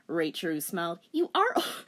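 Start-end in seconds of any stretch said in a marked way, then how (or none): 1.1-1.8 put-on high light young heroine; high girlish voice. A narrator's reading. Rachel taunting the witch, high girl voice. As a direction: pitch it up as the heroine.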